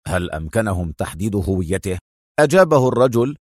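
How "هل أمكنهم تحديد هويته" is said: low, softer inquiry; interested question. A narrator's reading. Adham questions his surveillance man about the visitor, interested. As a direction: ask with quiet, focused interest. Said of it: "سأله أدهم في اهتمام".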